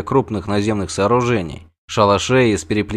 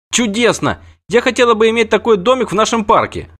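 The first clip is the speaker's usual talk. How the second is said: put-on much higher, bright child's voice; enchanted girl; 11 semitones higher than usual. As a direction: gush over it like a delighted child. Ciri enchanted.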